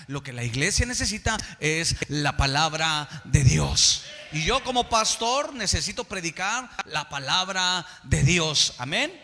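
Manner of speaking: loud, declarative pulpit delivery; emphatic preaching. Real, not acted. Pastor declaring what the church needs, emphatic preaching.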